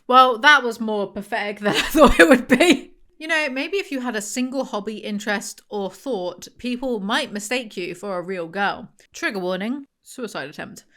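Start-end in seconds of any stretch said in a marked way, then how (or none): none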